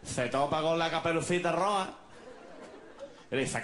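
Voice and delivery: hammed-up local yokel telling a tale; Málaga storyteller. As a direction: tell it low, rising to the line's end. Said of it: "contado por un malagueño, sería una cosa así".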